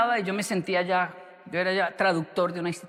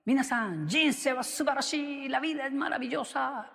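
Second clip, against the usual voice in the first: imitating a teacher's shout; 7 semitones higher than usual. Teacher on the desk shouting to the class, high and exuberant. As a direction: announce it from the desk like a crazy teacher. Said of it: "y gritaba".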